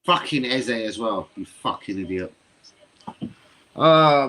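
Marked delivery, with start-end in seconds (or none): 3.7-4.3 2 a sudden loud anguished "Oh"; 3 cry out as the penalty is missed. Real, not acted.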